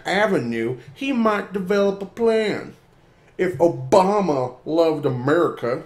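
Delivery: slow, emphatic read-aloud; dramatic reading. Acted. Reading an opinion piece aloud.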